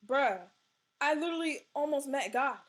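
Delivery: loud exasperated bruh, fast. Real, not acted. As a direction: blurt it out, fed up. Exasperated at siblings.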